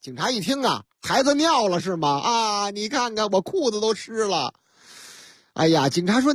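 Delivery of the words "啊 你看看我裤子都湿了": usual speaking voice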